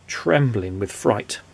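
plain reading voice

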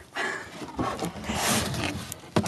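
airy breathy giggle. Real, not acted. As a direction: laugh at yourself. Laughs at her own obsession.

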